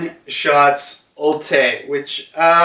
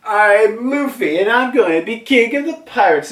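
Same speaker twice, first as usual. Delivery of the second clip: high, fast boast; cocky Luffy; 8 semitones higher than usual. Retold or imitated. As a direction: rattle off the pirate-king line proudly. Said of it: "Luffy does".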